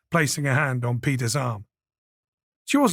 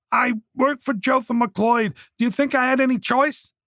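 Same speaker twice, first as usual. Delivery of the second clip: put-on a cornered young man begging; Oliver pleading; 8 semitones higher than usual. A narrator's reading. Oliver cowering at the door, begging Peter, higher.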